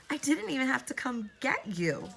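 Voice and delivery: high, eerie gloating voice; a witch pleased her prey came; eerie old woman. A narrator's reading. Woman gloats to Elizabeth, high and eerie.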